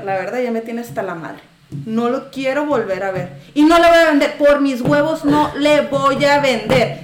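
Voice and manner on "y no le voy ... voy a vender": louder, furious refusal; angry retold yell. Vendor retelling shouting at a rude customer, angry. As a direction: refuse him with retold anger. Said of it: "le empecé a gritar".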